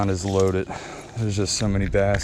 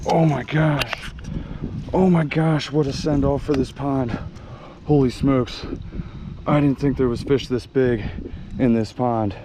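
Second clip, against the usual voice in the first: thrilled; 3 semitones higher than usual. Real, not acted. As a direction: let the joy burst out over the fish. Landing biggest bass of year.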